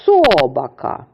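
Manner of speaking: "Собака" with СО long and loud; wrong first-syllable stress. Shown as a demonstration. Teacher shows pupils a misplaced stress, first syllable of "Собака" stressed. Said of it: "вимовимо це слово з неправильним наголосом".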